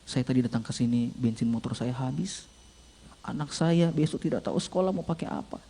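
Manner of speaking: soft, low voice of hardship; tearful confession, understated. Retold or imitated. Young man confessing hardship to the pastor, subdued and sad. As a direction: confide it quietly, retelling his sorrow. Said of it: "Ketika dia menangis".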